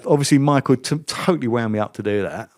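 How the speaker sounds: ordinary talk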